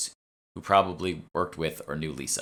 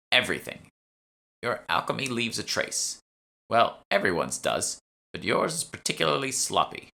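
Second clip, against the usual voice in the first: lightly voiced old woman, disapproving; a hint of a severe old lecturer; stern old shopwoman; 5 semitones higher than usual. Shopwoman criticizing sloppy alchemy, mildly stern old voice.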